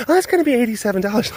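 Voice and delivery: high, quick cheerful clerk voice; a bright salesy price quote; chirpy sales clerk. Retold or imitated. Acted clerk quoting price.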